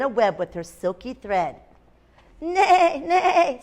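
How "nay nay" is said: high drawn-out neigh; horse neigh. A narrator's reading. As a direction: imitate a horse whinnying in a higher voice. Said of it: "said a horse".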